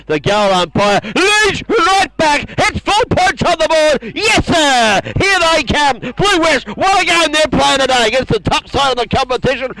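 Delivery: high, loud celebration of the goal; excited goal call. Real, not acted. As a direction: cheer it as the goal goes up. Goal scored, commentator celebrates.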